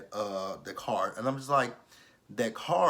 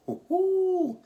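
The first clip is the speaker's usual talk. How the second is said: high, loud, drawn-out ooh; 7 dB louder than usual. Real, not acted. Vlogger reacting to the wig insult with a high, loud ooh. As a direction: squeal ooh like a shocked viewer. Reacting to the bad wigs dig.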